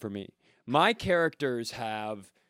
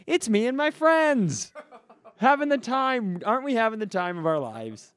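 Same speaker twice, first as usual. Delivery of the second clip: giddy fan; 7 semitones higher than usual. Retold or imitated. Fan posing with players for a photo, gushing. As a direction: gush like you're living the dream.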